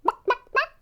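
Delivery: loud, high staccato clucking; chicken clucks. A narrator's reading. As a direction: cluck sharply like a hen. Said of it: "said a chicken".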